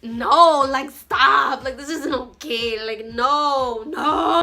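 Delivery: loud protest. Retold or imitated. Protesting the bad hair.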